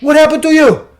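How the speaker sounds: loud, high-pitched outburst; acted-out shocked yell; shocked boss. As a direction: blurt it out high and loud, imitating a frantic boss. High boss sees injured teller.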